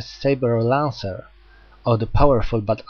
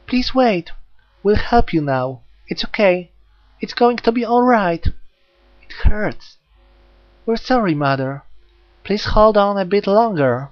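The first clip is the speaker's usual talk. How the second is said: put-on tender little girl; 9 semitones higher, 4 dB louder than usual. Jack comforting Reika, tender little-girl voice. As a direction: soothe her mother like a frightened child.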